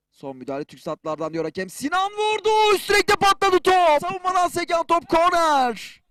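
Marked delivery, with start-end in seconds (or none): 1.7-6.0 excited commentator. Real, not acted. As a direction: cry out at the near miss. Replay of the crossbar shot.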